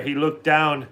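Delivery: usual speaking voice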